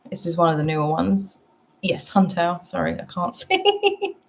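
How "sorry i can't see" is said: laughing apology. Real, not acted. Laughing while squinting at card.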